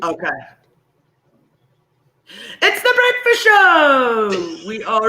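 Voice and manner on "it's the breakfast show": wholehearted announcement. Shown as a demonstration. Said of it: "do this one with all your heart".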